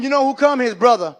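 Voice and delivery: ordinary talk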